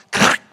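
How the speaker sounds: loud mock crack of a hammer. Preacher voicing the hammer blow into the peg, a loud crack. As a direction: smack it out like the blow.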